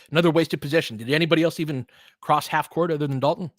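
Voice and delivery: usual speaking voice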